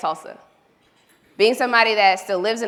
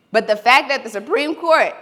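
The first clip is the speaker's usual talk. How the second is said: usual speaking voice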